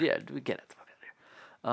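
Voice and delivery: breathy stage whisper of get out; whispered mock yell. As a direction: whisper it like a joke. Echoing the yelled line.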